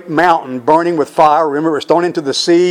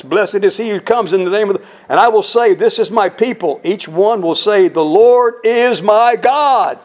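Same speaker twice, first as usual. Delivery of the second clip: climactic preaching; 5 semitones higher than usual. Acted. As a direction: lift it up and stretch the final words. Preacher climaxes, voicing remnant.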